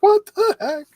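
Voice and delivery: a put-on shriek of disbelief; mock shock. Yamaguchi spotting the gorilla at the counter, mock shocked squeal.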